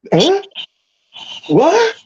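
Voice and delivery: high, baffled huh what; squeaky bewildered what; confused huh. Retold or imitated. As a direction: play it totally lost and confused. Mock confused reply.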